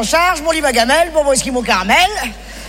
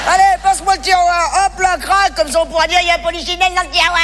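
higher, lively, bossy call; busy excitement; 5 semitones higher than usual. Acted. Comic building a drawer coffin, higher and eager. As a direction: bustle about giving orders.

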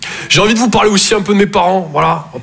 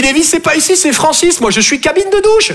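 much higher, indignant shop worker; high squeaky store employee; 10 semitones higher than usual. Retold or imitated. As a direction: pitch it way up, put out clerk. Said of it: "Il me dit".

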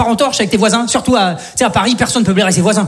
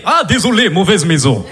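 put-on low Russian thug accent, apologetic; sheepish Russian accent; 4 semitones lower than usual. Russian mobster apologizing for the wrong house, Russian accent. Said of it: "Je fais bien l'accent comme ça".

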